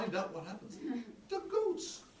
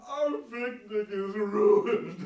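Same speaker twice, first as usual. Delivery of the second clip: loud, slow hammed-up wail; mock-sob it like a sad little goat; slow, loud mock crying goat; 6 semitones higher, 16 dB louder, much slower than usual. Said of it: "the goats all started to cry".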